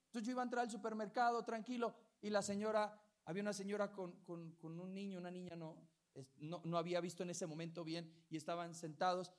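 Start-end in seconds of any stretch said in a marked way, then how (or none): none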